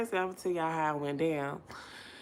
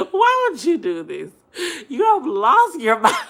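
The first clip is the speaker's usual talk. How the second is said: high, loud, laughing disbelief; laughing retort; 8 semitones higher, 9 dB louder than usual. Retold or imitated. To the ex parked outside, laughing and loud. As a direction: cackle it out at him. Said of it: "And I said".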